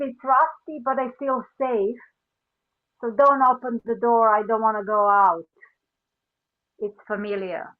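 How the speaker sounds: ordinary talk, nothing marked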